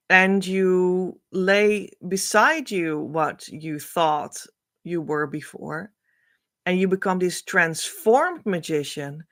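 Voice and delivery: ordinary talk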